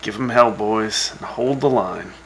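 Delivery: normal talking voice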